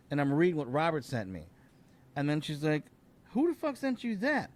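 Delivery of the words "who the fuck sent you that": high, startled question; sudden shocked outburst. Retold or imitated. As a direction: girlfriend startled by the message. Girlfriend shocked in retold story.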